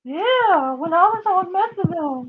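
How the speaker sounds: squeaky mock echo of yeah, when I was on methadone; mocking high voice. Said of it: "her voice changes pitch".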